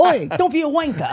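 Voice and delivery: loud, high British heckle, laughing; imitated British insult. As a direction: scold playfully in mock British. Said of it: "It's not even our language".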